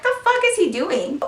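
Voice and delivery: high, incredulous mutter; puzzled by him pointing; confused girl watching her ex, high-pitched; baffled. Retold or imitated.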